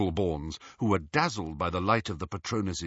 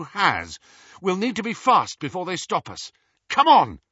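commanding; 6 semitones higher, 3 dB louder than usual. A narrator's reading. Harry commanding the group.

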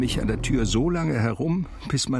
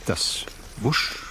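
breathy whoosh. A narrator's reading. Sound of skate blades on ice.

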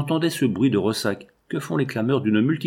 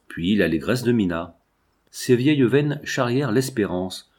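plain reading voice

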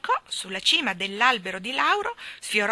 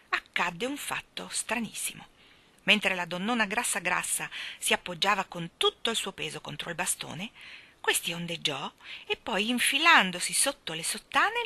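ordinary delivery

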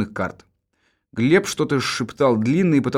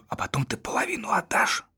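whispering; 10 semitones higher, 8 dB quieter than usual. Acted. Whispered aside in dialogue.

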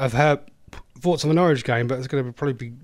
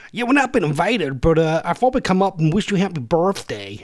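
higher, badly faked American accent; rubbish American accent; 4 semitones higher than usual. Gatecrasher bluffing the birthday girl, rubbish American accent. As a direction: put on a hammy, unconvincing American accent. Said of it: "my rubbish American accent".

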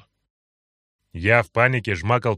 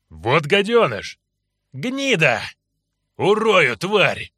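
building, higher furious yell; furious shout; 6 semitones higher, 4 dB louder, much slower than usual. Acted. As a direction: curse him louder and louder. Enraged companions cursing the hero. (яростный крик)

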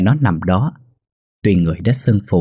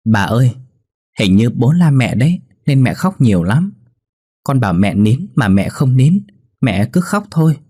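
put-on a little boy's voice climbing higher and louder toward the end; child confiding; 5 semitones higher, 3 dB louder than usual. Boy confides to his grandmother about his parents, in a child's voice rising higher. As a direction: tell grandma a worried secret like a small kid. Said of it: "Thằng bé xì xào với bà nội".

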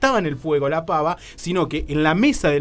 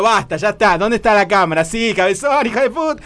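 louder, higher annoyed outburst; mock anger; 4 semitones higher, 6 dB louder than usual. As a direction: call out the hidden prankster. Busca la cámara oculta, enojado. (enojo fingido gritándole a la cámara oculta)

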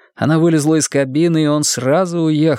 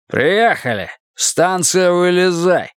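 slow, raised announcement that allows no argument; peremptory; 7 semitones higher, slower than usual. A narrator's reading. Husband throwing wife out of car, brooking no objection. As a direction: order her out, final. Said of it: "произнёс он нетерпящим возражений тоном".

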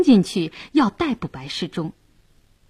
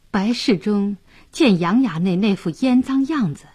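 plain reading voice

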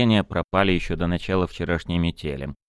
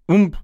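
sharp mock impact sound; a sudden heavy thump; loud thud; 11 semitones higher, 5 dB louder than usual. Thud of an unseen blow.

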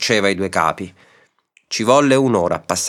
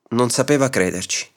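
plain reading voice

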